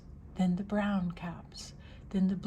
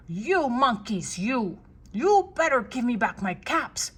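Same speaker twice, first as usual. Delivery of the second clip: quite angry peddler; 3 semitones higher, 8 dB louder than usual. A narrator's reading. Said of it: "Now he felt quite angry".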